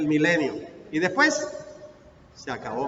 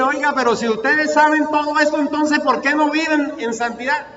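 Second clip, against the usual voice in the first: God's challenge; 7 semitones higher, 4 dB louder than usual. Acted. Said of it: "Es Dios el que dice".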